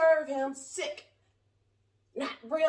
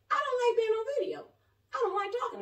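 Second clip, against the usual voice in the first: very high, plaintive protest; high-pitched reluctance; 10 semitones higher, faster than usual. Real, not acted. Speaker admits to viewers she hates filming, high reluctant protest.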